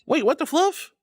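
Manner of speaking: surprised gasp. Real, not acted. Surprised at missed follow.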